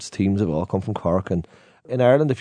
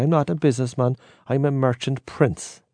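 higher-pitched mock Cork accent, slowing on the last words; bad Cork accent; 4 semitones higher than usual. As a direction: imitate a grand Cork man, accent badly done. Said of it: "worst attempt at a Cork accent".

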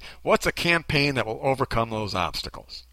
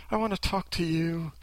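soft, higher, earnest imitation; imitated gushing sincerity, softened; mock sincere; 6 semitones higher, 7 dB quieter than usual. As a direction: coo it with put-on sincerity. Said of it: "that was so sincere and enthusiastic".

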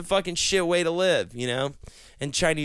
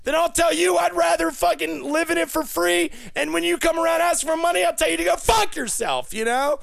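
shouting rant; 10 semitones higher than usual. Acted. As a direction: bark it at them as if they owe you. Ranting shout over music.

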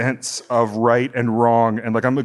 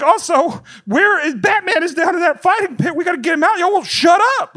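very high, frantic indignant shouting; worked-up movie fan losing it, pitch way up; exasperated rant. Retold or imitated. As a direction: build to a squealing shout at the talkers. Ranting at talkers over the movie.

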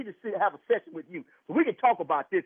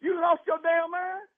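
angry; 8 semitones higher than usual. Real, not acted. Angry husband confronting the doctor.

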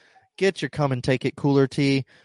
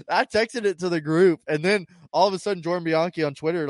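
ordinary talk

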